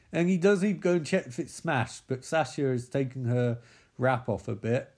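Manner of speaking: normal talking voice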